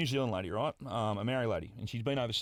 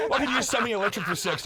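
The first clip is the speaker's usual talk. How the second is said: laughing joke; 9 semitones higher, 6 dB louder, faster than usual. Real, not acted. Joking retort.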